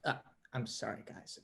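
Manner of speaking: normal talking voice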